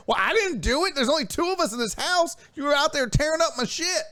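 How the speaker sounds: husband accusing his wife of tearing up his stuff, exasperated; blaming rant. Retold or imitated. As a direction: pile on the blame, half-joking. Acted quarrel with Nora.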